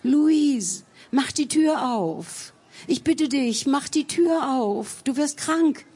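high, urgent begging through a door; pleading. A narrator's reading. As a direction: plead anxiously to be let in. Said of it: "flehte um Einlass".

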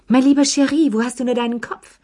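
high, loud put-upon mother's sigh; a mock-exasperated high sigh of reproach; sighing Madame Pelou. A narrator's reading. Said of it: "seufzte Madame Pelou". (Madame Pelou seufzt hoch und laut ihren Sohn an)

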